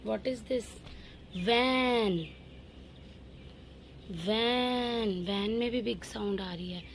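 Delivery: usual speaking voice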